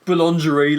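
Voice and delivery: "Boulangerie" loud, in plain English sounds; British mispronunciation. Shown as a demonstration. Host explains the bakery sign, butchered English-sounding "Boulangerie". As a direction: anglicize "Boulangerie" with full volume. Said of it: "That's the British way of saying it".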